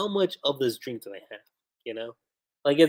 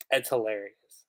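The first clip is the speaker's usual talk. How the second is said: ordinary talk, nothing marked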